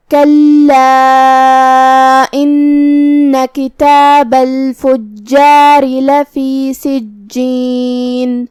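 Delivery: slow, drawn-out tajweed chanting; Quran recitation. Shown as a demonstration. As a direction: recite each word long and measured. Quran recitation after tajweed rules.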